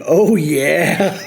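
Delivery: half-hearted catchphrase holler; mock yell. Narrator yelling back at the ghost, mild mock yell. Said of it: "I yell back instinctively".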